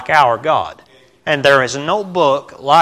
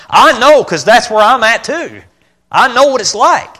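fired up; 9 semitones higher, 7 dB louder than usual. Real, not acted. Preacher rising to a peak.

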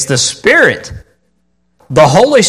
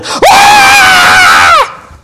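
put-on high shouted ah of teenagers; imitated joyful scream; 6 dB louder than usual. Preacher imitating teens screaming in praise, high shout. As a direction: let out a wild worship yell. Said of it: "hands in the air going".

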